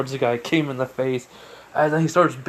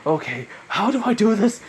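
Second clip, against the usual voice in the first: high, mildly panicked question; pitched up, unsure what to do; acted beginner's fluster; 8 semitones higher, 4 dB louder than usual. Hero unsure how to swing.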